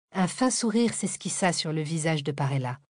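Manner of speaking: usual speaking voice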